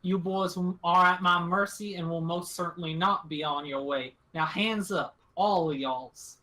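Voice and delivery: lightly voiced rural captor giving orders; country-talking woman holding them at gunpoint; drawling gun-toting country woman.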